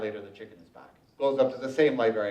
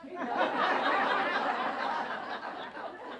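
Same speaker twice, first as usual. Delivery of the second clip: high, long burst of laughter; 9 dB quieter than usual. Real, not acted. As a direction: cackle at your own joke. Laughs at own punchline.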